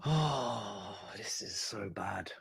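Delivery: slow hushed dismay; despairing whisper. Real, not acted. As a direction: barely voice it, crushed. Despairing at the match.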